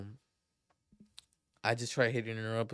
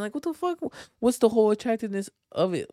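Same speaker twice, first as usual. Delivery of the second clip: much higher and louder, disbelieving; outraged outburst; 11 semitones higher, 8 dB louder than usual. Real, not acted. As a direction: blurt it out in disgust. Outraged rhetorical question.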